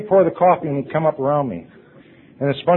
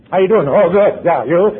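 hearty loud greeting; 6 semitones higher, 10 dB louder than usual. Acted. Acting out greetings at meeting.

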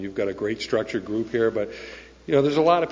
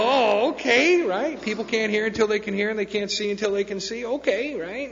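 much higher-pitched, going along with it; high mock-compliant reply; retold puzzled agreement; 10 semitones higher than usual. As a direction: act out agreeing in a high put-on voice. His own baffled reply, retold.

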